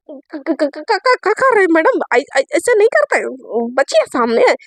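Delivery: flustered stammer. Acted. Satya jumps up protesting to Ayesha, stammering. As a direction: get stuck on each first syllable, then object.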